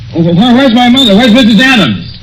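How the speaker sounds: high, stammering frightened questions; desperate to find his mother; panicked stammer. Acted. Son demands to know where his mother is, high and panicked.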